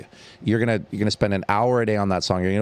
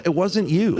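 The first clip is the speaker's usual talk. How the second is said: ordinary talk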